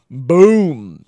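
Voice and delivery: very loud, stretched boom; impact boom. A narrator's reading. Recapping the ground punch with a loud drawn-out boom. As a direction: slam the word like a punch.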